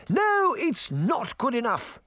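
high, slow, forceful shout; Queen shouting. Acted. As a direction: hammer the no home. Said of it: "the Queen shouted".

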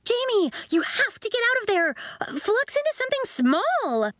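put-on girl calling across the room to Jamie; urgent girl. A narrator's reading. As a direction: urge your friend out of danger. Girl urging boy to escape.

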